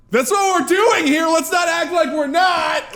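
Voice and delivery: high, loud indignant protest; re-enacted exasperated outburst; shouted self-defense. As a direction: shout your case to a shocked room. Defends himself to shocked class.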